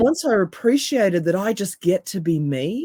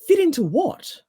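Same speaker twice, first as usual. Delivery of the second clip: incredulous; 11 semitones higher than usual. Real, not acted. Rhetorical challenge to the quote.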